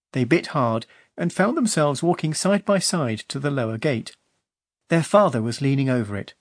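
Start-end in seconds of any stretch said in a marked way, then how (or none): none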